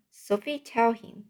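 usual speaking voice

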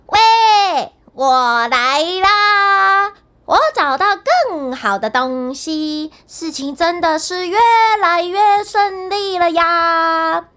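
高声喊, 拉长我来啦; 在后面得意地喊. A narrator's reading. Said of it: "又在后面喊道". (高声拖腔呼喊)

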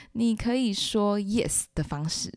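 normal talking voice

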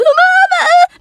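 high, loud desperate cry of mama; frantic call to mom. Retold or imitated. Scared daughter phoning her mother, high loud panicked call. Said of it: "我说".

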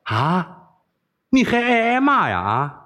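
high, incredulous question; exasperated amusement. A narrator's reading. Wei Xiaobao baffled she likes insults, exasperated and amused. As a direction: ask it high, half annoyed, half amused. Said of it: "韦小宝是又好气又好笑".

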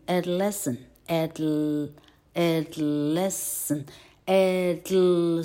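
adolescent broken into slow pieces; slow drill. Shown as a demonstration. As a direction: drill adol- with a dark L. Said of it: "slow drill".

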